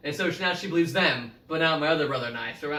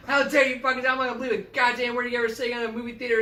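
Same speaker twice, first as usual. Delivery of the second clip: fast, much higher ranting tirade; imitated furious mom; 8 semitones higher, much faster than usual. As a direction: rattle off a mom's furious scolding. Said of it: "she's just cursing a storm".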